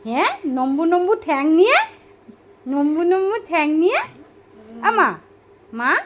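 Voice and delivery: high, swooping playful teasing. Real, not acted. Mother teases baby about long legs, high baby talk.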